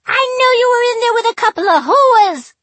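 put-on shrill high New Jersey accent saying hooers; a screaming New Jersey girlfriend accent. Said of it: "pronounced it in that New Jersey accent".

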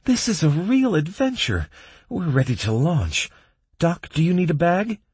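very high, eager squeak; thrilled high-voiced adventurer; Kalen squeaking. A narrator's reading. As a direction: squeak with excitement, pitch way up. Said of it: "squeaked Kalen".

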